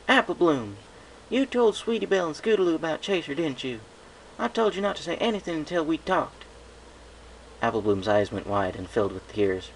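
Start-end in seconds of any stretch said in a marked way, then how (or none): 0.1-6.4 scolding. Acted. Applejack scolds her sister.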